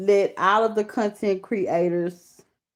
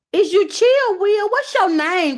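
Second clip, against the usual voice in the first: calling out; 14 semitones higher, 5 dB louder than usual. Real, not acted. Calling out to a viewer.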